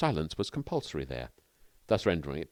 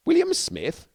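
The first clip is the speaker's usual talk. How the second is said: sharply higher, louder disbelieving echo of the name; startled question; 7 dB louder, slower than usual. Acted. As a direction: repeat the name back in shock. Bill startled by the alias.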